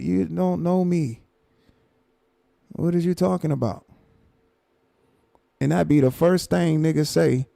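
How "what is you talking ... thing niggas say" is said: ordinary talk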